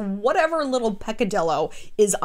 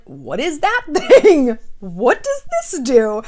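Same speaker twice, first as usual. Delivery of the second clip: louder, slow, laughing curiosity; curious laughing; 4 semitones higher, 9 dB louder, slower than usual. Retold or imitated. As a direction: giggle through the questions, poking around. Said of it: "Even if you're just like".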